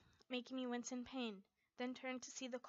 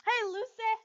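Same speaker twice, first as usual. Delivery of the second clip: eager grin; 10 semitones higher, 12 dB louder than usual. A narrator's reading. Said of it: "wearing a wide grin".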